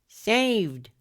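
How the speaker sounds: a high, thrilled cry of saved; excited shout. A narrator's reading. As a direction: celebrate the save, pitching up high. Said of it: "Franklin shouted".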